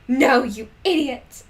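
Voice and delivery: hammed-up insult; a hint of teen fury; shouting. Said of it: "I shouted".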